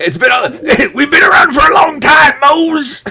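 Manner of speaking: high, loud, laughing cartoon crab voice; laughing hammed-up crab voice; Mister Krabs voice. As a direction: squawk it out like a cartoon crab boss. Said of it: "Kinda sounded like Mister Krabs".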